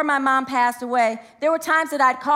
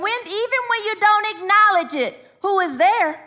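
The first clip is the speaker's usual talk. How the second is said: rising sermon preaching; 3 semitones higher than usual. Acted. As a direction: preach it, climbing to a call. Preacher building to a climax.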